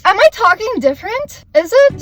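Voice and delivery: high, loud questioning; checking own odd voice; surprised at own voice. Real, not acted. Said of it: "I don't know why I'm talking like this".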